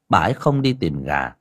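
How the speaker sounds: plain reading voice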